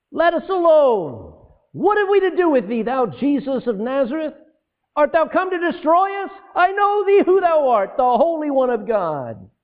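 high, loud, pleading demon voice; demon's outcry. Acted. Preacher voicing the demon's cry to Jesus, high and loud. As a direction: cry it out as the possessed man.

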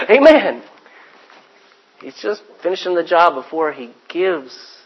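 ordinary talk, nothing marked

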